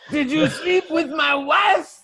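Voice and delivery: mock jealous shout. Jealous husband confronts counsellor.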